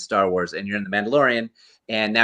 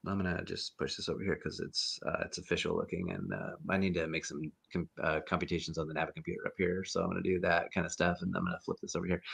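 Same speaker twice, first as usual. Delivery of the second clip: soft, fast private muttering; much softer, rapid mutter under the breath; inner muttering; 3 semitones lower, 14 dB quieter, faster than usual. Retold or imitated. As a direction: mumble your plan to yourself, quick and hushed. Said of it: "I was like".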